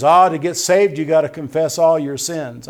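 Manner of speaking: loud, challenging mock objector. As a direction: boom it out like a doubter. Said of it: "Someone says".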